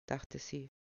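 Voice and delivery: ordinary delivery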